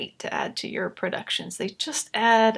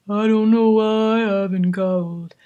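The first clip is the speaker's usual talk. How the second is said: changed vocal tone; 6 dB louder than usual. Shown as a demonstration. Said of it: "changed the tonality of my voice".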